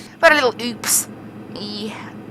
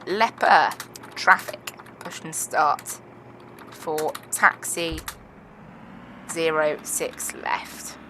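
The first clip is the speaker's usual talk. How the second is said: pilot over the radio; 4 semitones lower, 9 dB quieter, much slower than usual. Acted. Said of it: "So I'll send that on Unicom".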